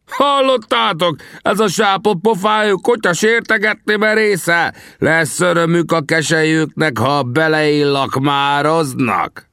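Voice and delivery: very high, sustained warrior's howl; high-pitched threatening rant, drawn out at the end; outraged bellow. A narrator's reading. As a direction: rage to the crowd at the insult. Enraged warrior rants to onlookers.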